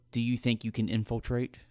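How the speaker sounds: soft, serious in-character question; hushed check on a teammate; Roscoe. Acted. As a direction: ask it quietly and gravely. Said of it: "Roscoe looks at Margot and goes".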